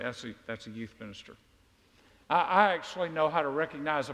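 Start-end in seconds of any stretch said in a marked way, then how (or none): 0.0-1.4 quiet knowing aside. Retold or imitated. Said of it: "And so I say".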